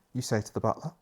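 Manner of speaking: usual speaking voice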